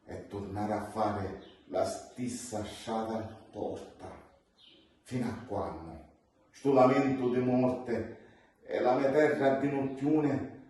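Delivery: usual speaking voice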